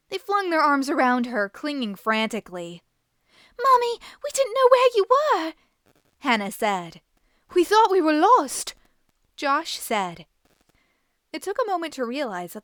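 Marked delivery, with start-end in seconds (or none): 3.6-5.5 high, loud, breathless relief; relieved cry. A narrator's reading. Children hug the woman, crying out high and loud with relief. Children run to supposed mother. 7.5-8.7 high, loud, breathless relief; relieved cry. A narrator's reading. Children hug the woman, crying out high and loud with relief.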